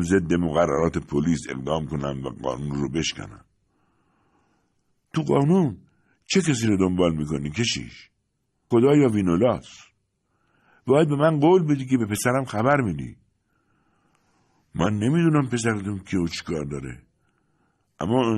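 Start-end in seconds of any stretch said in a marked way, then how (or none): 5.1-13.2 put-on higher, louder, challenging old mother; a pleading old woman's rising challenge; urgent old woman. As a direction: press the priest hard for a promise.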